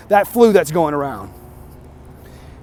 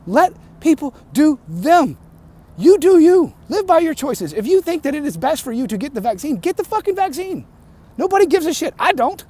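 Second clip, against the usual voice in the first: heated outburst; 6 semitones higher than usual. Real, not acted. Heated climax of vaccine rant.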